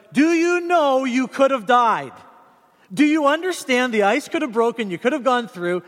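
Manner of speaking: worried angry dad. Retold or imitated. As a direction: drive home the danger to the son. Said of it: "I just went through the whole".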